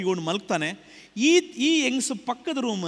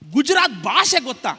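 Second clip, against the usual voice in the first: demanding question; 5 semitones higher, 8 dB louder than usual. Retold or imitated. Husband questions wife in story.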